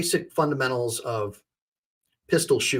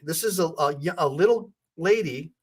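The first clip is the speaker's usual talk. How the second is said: usual speaking voice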